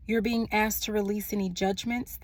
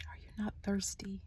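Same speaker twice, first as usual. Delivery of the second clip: hushed whisper of the heard words; whispered message; 10 dB quieter than usual. Retold or imitated. Reader repeating a spirit's question, whispering. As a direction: drop into a whisper mid-sentence. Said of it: "I heard".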